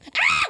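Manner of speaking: very high delighted shriek; mock excited squeal. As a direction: squeal like a smitten girlfriend getting his call. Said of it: "so I'll be Ashley".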